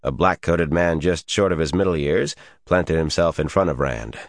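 ordinary delivery, nothing marked

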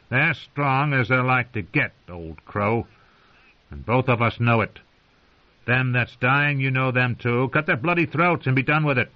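rough sneering wildling. A narrator's reading. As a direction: sneer at the unwelcome guests.

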